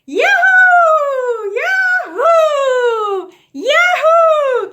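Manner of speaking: high, very loud whooping cheer; joyful shout. Shown as a demonstration. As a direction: throw your arms up and yell with glee. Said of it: "I wanna hear everybody".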